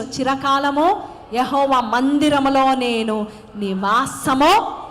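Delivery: loud, ringing pulpit blessing; loud preacher blessing the crowd; full-force preaching. Acted. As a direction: pour the psalm out like a revival preacher.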